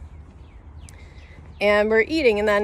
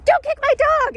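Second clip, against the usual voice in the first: retold shrieking yell, much higher and louder; mock yell; 4 dB louder than usual. Dog owner yelling at friend, high mock shriek. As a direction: imitate a frantic owner shrieking at him.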